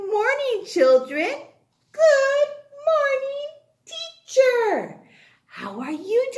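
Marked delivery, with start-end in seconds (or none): 1.9-5.0 put-on child voice. Children answer in chorus.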